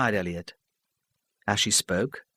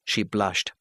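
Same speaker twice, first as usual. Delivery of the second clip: plain reading voice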